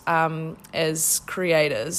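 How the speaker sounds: ordinary talk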